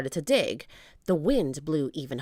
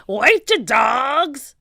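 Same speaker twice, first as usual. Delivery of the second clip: slow, loud, raised holler; pirate bellow; 7 semitones higher, 10 dB louder, much slower than usual. A narrator's reading. Captain wading after the rowboat, bellowing. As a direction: drag out the shout at the crew.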